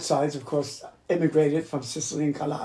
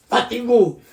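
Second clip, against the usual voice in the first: higher, louder parting squawk; cursing mynah bird; 4 semitones higher, 6 dB louder than usual. Retold or imitated. Mynah swearing once you walked away, squawked. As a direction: throw the curse after someone like a talking bird. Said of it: "and then he would say".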